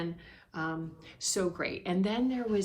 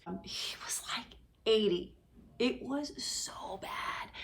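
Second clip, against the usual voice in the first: whisper; 2 semitones higher, 4 dB quieter, slower than usual. Real, not acted. Confiding how bad it was.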